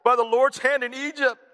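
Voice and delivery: mock whining grumblers; whining Israelites. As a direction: moan like a spoiled crowd. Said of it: "The Israelites said to them".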